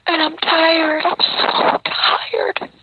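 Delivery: worn out and on the edge of crying; real exhausted tearful plea; emotional, near tears. Real, not acted. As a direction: voice climbing high, slowing, close to breaking. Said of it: "I'm getting emotional".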